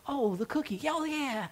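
high, breathy delight at the cookie; delighted relief. Retold or imitated. Pastor acting out joyful relief over a cookie. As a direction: swoon over the cookie like it's salvation.

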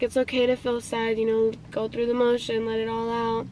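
soft, low consoling voice; gentle retold reassurance. Friend lets her cry and feel sad, softly reassuring. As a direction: quietly imitate him giving comfort.

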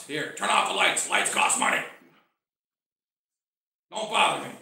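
imitating a cross old priest; lightly voiced irritable pastor, brushing someone off; mock-cross impression of an old pastor; grumpy old pastor. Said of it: "When he was angry".

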